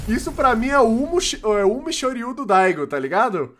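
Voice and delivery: fired up; excited. Real, not acted. Hyped comparison to famous play.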